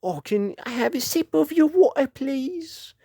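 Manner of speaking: high, sweet asking voice, fading on please; lightly put-on begging tone; mock polite classmate. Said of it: "Comes up to me and goes".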